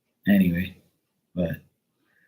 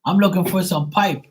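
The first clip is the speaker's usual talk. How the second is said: mock innocent request; 9 semitones higher, 4 dB louder than usual. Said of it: "if you went into Home Depot and say".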